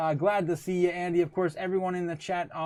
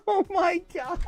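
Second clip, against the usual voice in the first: breaking into real laughter, voice pitched way up; laughing hard; 4 dB louder than usual. Real, not acted. Streamer cracking up at a chat joke, laughing hard. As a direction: squeal it out through helpless laughter.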